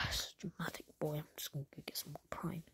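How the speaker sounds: quick unvoiced whisper; muttered aside, sneaking off for Prime. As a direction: hush it so nobody wakes. Sneaking off while others sleep.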